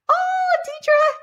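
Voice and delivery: high, loud delighted call of a name; thrilled to spot her name. Real, not acted. Host spotting a viewer's comment, delighted.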